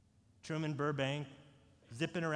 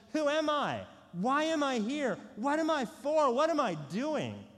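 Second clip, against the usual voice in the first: crying out the questions; 9 semitones higher, 5 dB louder than usual. Retold or imitated. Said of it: "We ask the question".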